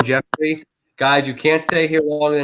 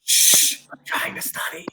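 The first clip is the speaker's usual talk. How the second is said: hushed, unvoiced librarian whisper; mock librarian whispering; whispered librarian shush; 5 dB quieter, slower than usual. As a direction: shush them like a strict librarian, all in a whisper. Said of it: "It's like a librarian look".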